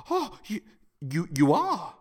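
sharp gasp, then stammering delight; gasping surprise. Acted. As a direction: catch your breath, stunned and thrilled. Thrilled she is interested.

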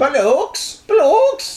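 put-on very high, squeaky English accent; mock English accent. Host still attempting bollocks, squeaky mock English accent. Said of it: "bothering with the English accents".

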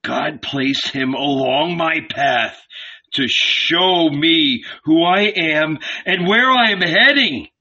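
despairing. A narrator's reading. Hermit despairing realization.

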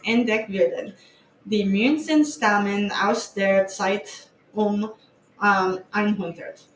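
halting, restarting German words; terrible German. Real, not acted. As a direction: sound out German, stumbling. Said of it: "my terrible German".

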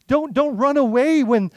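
ordinary talk

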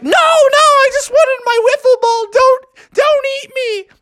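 very high squealing plea; frightened pleading. Acted. Froggy pleads with the turtles not to eat him, terrified. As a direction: beg in hammed-up terror.

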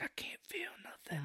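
breathless, voiceless whisper of a dying man. Retold or imitated. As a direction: barely get the words out, fading. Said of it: "He even says".

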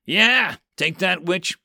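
high, loud victorious shout; triumphant yell. Acted. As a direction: crow it at the enemy. Hero yells at the witch.

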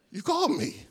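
put-on 1 boy's question. Boy wakes Eli.

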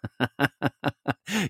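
laugh. Real, not acted. Laughing at daughter's reaction.

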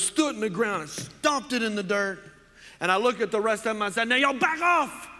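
Boudreaux. Acted. Boudreaux brags to Saint Peter about the bikers, Cajun bluster. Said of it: "my Cajun accent is horrible".

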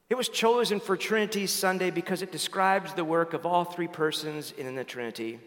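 normal talking voice

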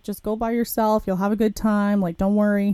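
normal talking voice

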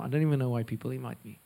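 usual speaking voice

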